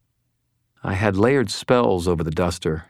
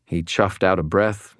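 plain reading voice

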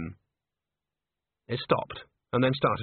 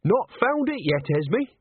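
high, bright, slow cheeky answer; cheerful cheek; 10 semitones higher, 3 dB louder, slower than usual. Acted. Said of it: "said Nanny brightly".

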